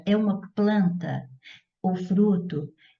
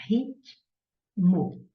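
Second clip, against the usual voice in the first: "ritmo" split as rit... mo, clear pause between; syllable by syllable. Shown as a demonstration. Teacher dividing a word for students, "ritmo" said in two syllables. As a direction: sound out "ritmo" in two separate beats. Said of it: "separei em sílabas".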